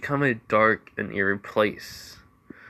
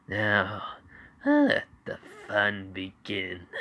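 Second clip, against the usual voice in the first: menacing relish; slower than usual. Acted. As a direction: savor it like a sadistic villain. Villain Izuku starting his game.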